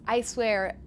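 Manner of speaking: usual speaking voice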